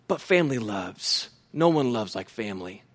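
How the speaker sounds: tender closing. Acted. Preacher softens after rising section.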